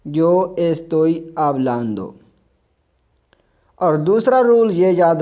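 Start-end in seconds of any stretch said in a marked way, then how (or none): 0.0-2.1 very slow, carefully articulated yo estoy hablando; slow model sentence. Shown as a demonstration. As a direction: spell the sentence out slowly for students. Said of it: "A R को हटाकर हमने A N D O".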